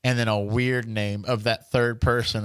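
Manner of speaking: usual speaking voice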